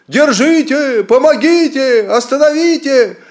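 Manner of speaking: high, loud, panicked wail; wailing cry for help. A narrator's reading. The lord wailing for anyone to stop him, panicked howl. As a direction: howl for rescue in terror. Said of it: "заголосил".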